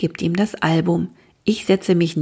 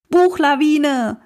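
alarmed cry; 9 semitones higher, 4 dB louder, slower than usual. A narrator's reading. Books crashing down, warning cry.

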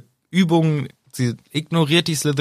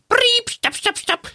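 very high, piping mock whistle and stop calls; imitated whistle stop; slower than usual. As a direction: pipe out a whistle, then repeated high stops. Imitates whistle halting the training. (hoher, nachgeahmter Pfiff mit Stopprufen)

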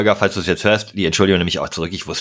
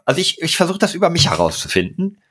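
raised pitch, urgent pleading; high agitated pleading; 8 semitones higher than usual. Real, not acted. Agitated pleading about Holger.

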